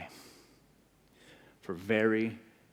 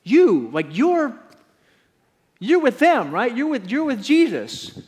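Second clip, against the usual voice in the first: put-on much higher, louder girl's voice; accusing servant girl; 12 semitones higher, 9 dB louder than usual. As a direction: point at him and call him out. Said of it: "And she says".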